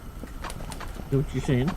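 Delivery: slow breathy whisper to the cat. Shown as a demonstration. Asking the cat again in a slow whisper. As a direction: whisper it slowly. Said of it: "repeated softly".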